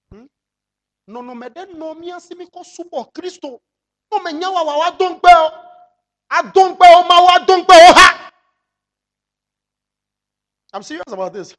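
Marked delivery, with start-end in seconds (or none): 4.1-8.3 loud high shouting; excited shout. Acted. Preacher shouting to the church in his own language. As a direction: cry it out at full force.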